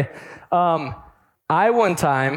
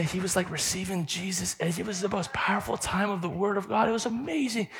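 softer, awed retelling; hushed awe; 4 semitones higher, 8 dB quieter than usual. Retold or imitated. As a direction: tell it like a sacred secret. Breathless awe telling mom.

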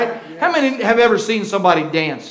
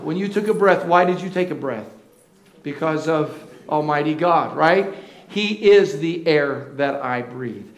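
ordinary talk, nothing marked

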